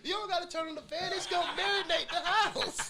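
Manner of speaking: high giggling words; laughing. Real, not acted. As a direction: talk through giggles. Fart joke, laughing fit.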